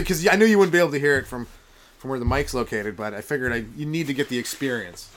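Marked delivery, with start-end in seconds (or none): none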